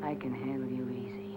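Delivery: breathy whisper, low and knowing; seductive whisper. Acted. Woman whispering back to the man who flirts. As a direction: whisper it close, teasing him.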